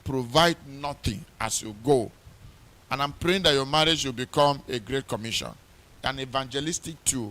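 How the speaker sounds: loud, ringing pulpit preaching; fervent wedding sermon preaching. Acted. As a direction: preach it out with full force. Preaching charge to couple.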